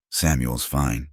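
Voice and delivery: usual speaking voice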